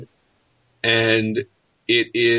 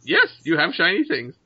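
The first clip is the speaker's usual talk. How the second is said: high-pitched creature voice; eager bird creature; 6 semitones higher than usual. Acted. Bird leader answers adventurer about shiny things, high creature voice.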